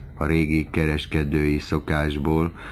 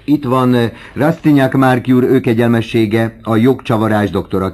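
loud, raised-pitch announcement; kiáltás; 6 semitones higher, 7 dB louder than usual. A narrator's reading. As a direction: proclaim a grand arrival to the room. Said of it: "kiáltott Bianchon". (hangosan kikiáltott bejelentés)

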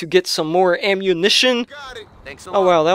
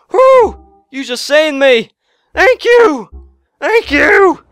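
much higher, loud and gushing; excited thanks; 6 semitones higher, 4 dB louder, slower than usual. Real, not acted. Gamer thanking Brucey for the rescue, squealing.

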